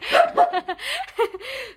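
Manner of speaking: 思わず出た野太い笑い声; 太く低く笑ってしまう; 太い声の笑い. Real, not acted. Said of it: "めっちゃのぶとい声出しちゃった". (野太い笑い声)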